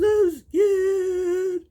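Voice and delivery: high, loud mock crying whimper; mock scared wail. Mocking the weeping bride to her mother, high loud whimper. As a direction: imitate a bride blubbering. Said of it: "She goes".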